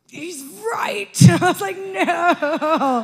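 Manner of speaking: high, loud, laughing no; laughing protest. Retold or imitated. Realising her husband was right, laughing and loud. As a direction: laugh through the refusal. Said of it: "And I was like".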